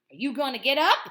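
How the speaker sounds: loud, harsh driver's challenge; demanding shout. Acted. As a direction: confront her loudly. Bus driver confronts her.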